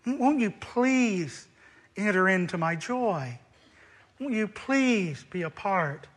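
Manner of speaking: soft, slow, brokenhearted plea; father softly pleading with his elder son; tender pleading. Acted. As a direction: beg him gently to come in. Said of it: "brokenhearted and in love and compassion pleaded".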